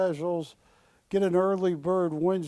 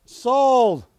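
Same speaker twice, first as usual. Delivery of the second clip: loud, higher, rapid auction call; auctioneer shout; 7 semitones higher, 8 dB louder than usual. Acted. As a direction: bang the sale home. Auctioneer closing the sale.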